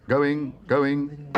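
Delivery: loud, high auctioneer's chant; auctioneer's call. A narrator's reading. Auctioneer closing the sale to Paddington, calling out. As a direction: sing it out before the hammer falls. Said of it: "called the auctioneer".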